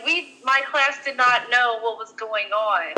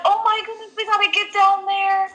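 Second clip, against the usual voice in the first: mom going hysterical; 7 semitones higher than usual. Retold or imitated. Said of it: "She was going hysterical".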